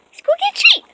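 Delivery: very high sing-song dog voice; talking to a dog. Real, not acted. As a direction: coax the dogs, up high. Calling dogs, pitched higher.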